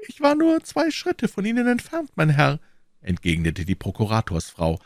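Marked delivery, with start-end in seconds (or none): 0.1-2.6 very high falsetto lady, reproachful; offended procurator's wife. Acted. (gekränkte Frau in hoher Fistelstimme)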